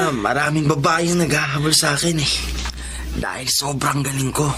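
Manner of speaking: boastful whisper. Acted. Husband boasts to mistress.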